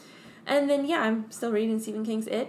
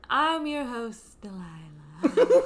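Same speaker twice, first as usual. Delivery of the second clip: mock host voice; 3 semitones higher, 3 dB louder, slower than usual.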